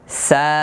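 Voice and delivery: সা with a long, light 'aa'; one-alif stretch. Shown as a demonstration. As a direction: hold the vowel level, then stop cleanly. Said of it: "এভাবে".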